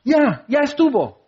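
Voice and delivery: gritos agudos y urgentes de ¡Ya!; shouting at the screen. Retold or imitated. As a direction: grita a la pantalla que paren. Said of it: "casi gritando a la pantalla". (gritando a la pantalla para que paren la película)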